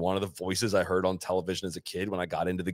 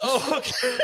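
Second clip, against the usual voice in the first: a higher polite oh okay through a laugh; nice polite brush-off; 13 semitones higher, slower than usual. Retold or imitated. Retelling Bobby's reply, a higher laughing polite oh okay. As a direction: imitate the friendly dismissal again while laughing. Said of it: "He didn't say it dickish".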